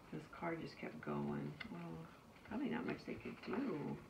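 ordinary delivery, nothing marked

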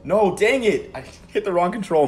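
high, loud annoyed outburst; exasperated groaning shout; frustrated yell. Real, not acted. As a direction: curse the misclick out loud. Misclicks in the game.

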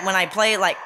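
ordinary delivery

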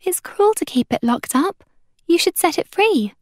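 put-on high, earnest young voice; girl's plea. A narrator's reading. Girl moved by a caged cat, pleading. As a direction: plead on the animal's behalf.

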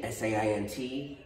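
ordinary talk

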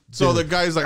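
ordinary delivery

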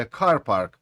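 "car" stressed, "park" falling away; first-word stress in "car park". Shown as a demonstration. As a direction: hit car, let park drop. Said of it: "Так само буде".

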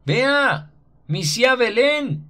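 high, slow, hoarse call after her; hoarse shout. A narrator's reading. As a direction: yell hoarsely after the girl. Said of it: "le grita ronco".

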